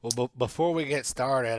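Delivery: usual speaking voice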